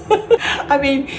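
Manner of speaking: high giggle breaking into words; real bursting laugh over a lost bowl. Real, not acted.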